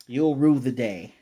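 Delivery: loud, drawn-out parting threat; retold yell. Brother yelling a threat at Lloyd, loud and slow. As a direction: fling the threat back while running off. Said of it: "he's yelling and running away".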